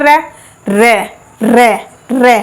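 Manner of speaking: "रे" said as separate, deliberate syllables; repeated sound demo. Shown as a demonstration. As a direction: sound out each "रे" from the gum ridge. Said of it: "बोलना एक बार".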